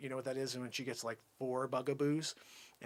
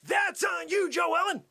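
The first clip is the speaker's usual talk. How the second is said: raised, high-pitched insistence; emphatic blame; 7 semitones higher, 3 dB louder than usual. Acted. Salesman pins the loss on Joe Ellen, shouting. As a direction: push the blame back forcefully.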